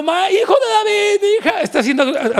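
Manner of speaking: high pleading cry to Jesus; imitating a mother's pleading cry; desperate mother crying out. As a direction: beg like a mother at the end of her rope. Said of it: "esta mujer estaba clamando".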